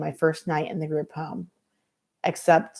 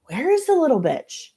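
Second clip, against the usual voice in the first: angry; 9 semitones higher than usual. Acted. Kelly storms in looking.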